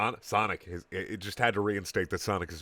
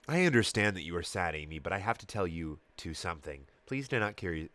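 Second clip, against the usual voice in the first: sad and sincere; 3 semitones lower, 4 dB quieter than usual. A narrator's reading. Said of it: "in a very sad and meaning it way".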